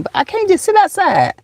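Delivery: high-pitched playful whine; exaggerated high lament; mock complaint. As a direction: protest in a held, high put-on voice. Mock complaint about the mosquitoes.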